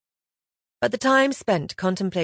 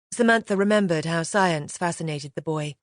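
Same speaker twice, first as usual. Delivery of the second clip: plain reading voice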